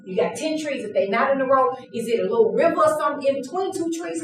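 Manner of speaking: ordinary delivery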